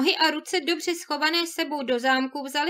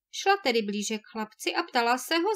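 usual speaking voice

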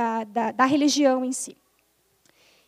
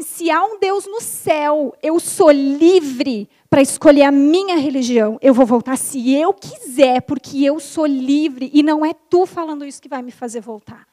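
defiant declaration; 10 dB louder than usual. Retold or imitated. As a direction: stand your ground and proclaim it. Defying the spirit.

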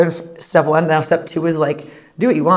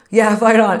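louder, higher mock rah-rah cheer; 5 semitones higher, 7 dB louder, slower than usual. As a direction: cheer it like a proud alum parent. Parents cheering for their school.

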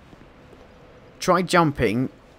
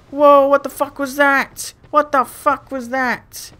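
1 startled fright; 13 semitones higher, 6 dB louder, slower than usual. Real, not acted. Scared by a face on a tree.

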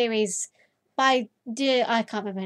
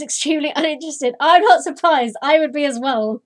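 normal talking voice

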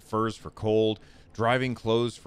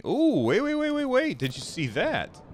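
excited; 3 dB louder than usual. Real, not acted. Spots something in the game.

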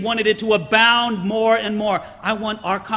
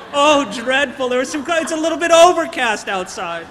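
mock whine, louder and higher; mock whining; 5 semitones higher, 6 dB louder than usual. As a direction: whine about the clouds as if it were a disaster. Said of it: "we whine and complain".

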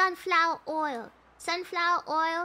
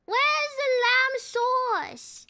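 slow, drawn-out chef's bellow, pitched high; angry chef; 5 semitones higher, 3 dB louder, much slower than usual. Acted. Player playing an angry chef in the kitchen, mock bellow. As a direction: stretch it out like a chef demanding it.

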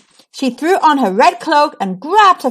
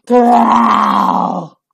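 wolf growl. A narrator's reading. The wolf growls at Ruby from the bushes, rumbling growl.

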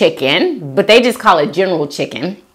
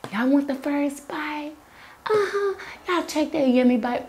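soft, hushed invitation to take a bite; soft coaxing; 7 semitones higher, 11 dB quieter than usual. Real, not acted. Inviting viewers to share her first bite, soft coaxing.

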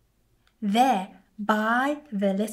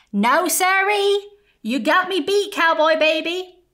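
much higher, louder sheriff giving up; called out; 8 semitones higher, 7 dB louder than usual. Acted. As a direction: call out cheerfully conceding. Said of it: "called Sheriff Parr".

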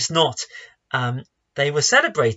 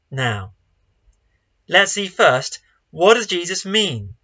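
ordinary talk

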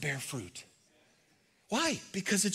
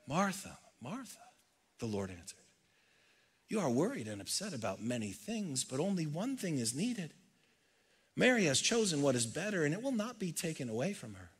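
soft, tender rebuke, much quieter; gentle Jesus; 8 dB quieter than usual. Acted. Jesus answering a worried Martha, softly tender. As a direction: hush down and speak with loving patience.

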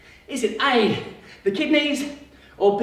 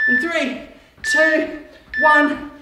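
building countdown; 7 semitones higher, 4 dB louder, much slower than usual. Acted. Coach counting down to the answer, building excitement. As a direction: count down getting higher and louder.

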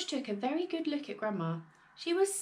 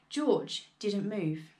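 usual speaking voice

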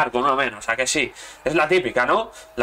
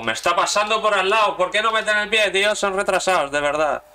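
higher and louder, ranting at the screen; frustrated outburst; 8 semitones higher, 3 dB louder than usual. Real, not acted. Gamer yelling at his own players, angry rant. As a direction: vent at the useless defenders.

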